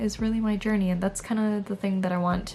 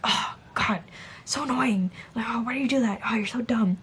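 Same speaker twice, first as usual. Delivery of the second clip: hushed, exasperated inner voice; whispered self-scolding; 3 semitones higher than usual. Retold or imitated. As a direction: scold yourself under your breath. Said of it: "this is how I sound in my own head".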